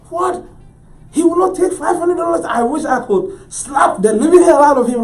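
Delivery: very high, loud exasperated outburst; outraged disbelief. Retold or imitated. Broke artist's inner reaction to dealer's refusal, high-pitched outrage. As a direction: squawk in horrified disbelief.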